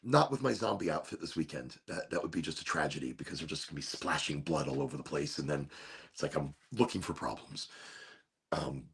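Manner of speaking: a quiet confiding afterthought; soft aside. Real, not acted. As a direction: drop the voice to a low aside. Muttered aside about zombie outfit.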